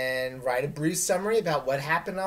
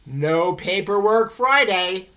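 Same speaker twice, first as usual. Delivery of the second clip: slow, loud, showy announcement; announcing it proudly; 4 semitones higher, 9 dB louder, slower than usual. Real, not acted. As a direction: sell it like a big event. Teacher hyping a fun day.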